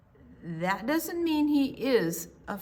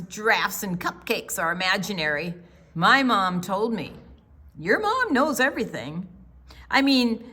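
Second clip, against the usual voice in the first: ordinary delivery, nothing marked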